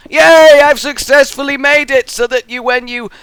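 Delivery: very high, loud celebratory squeal; over-the-top cheer. Pretend. Cheers own building success.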